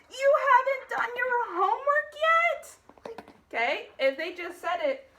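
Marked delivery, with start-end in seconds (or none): 0.1-2.8 high, loud, near-crying mom outburst; high, upset exclamation. Shown as a demonstration. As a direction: cry it out in exasperation. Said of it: "They're saying".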